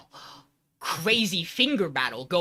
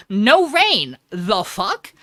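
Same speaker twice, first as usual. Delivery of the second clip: loud, higher mock disbelief; acted bafflement; 4 semitones higher, 8 dB louder, much slower than usual. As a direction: punch it out, comically baffled. Acting cops baffled by raincoat.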